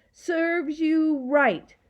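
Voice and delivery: high, loud, drawn-out jeer; nasty scream. A narrator's reading. Gaboon racing up to the crying girl, screaming nastily. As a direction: draw it out spitefully. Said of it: "screamed nastily".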